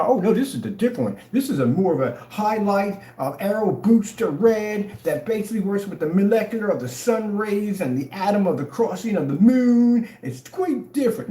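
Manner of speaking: high, hammed-up sales pitch; mock salesman. Imitating a seller pitching a repainted product, hammed-up and high. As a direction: oversell it with fake science. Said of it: "go up there and change the color".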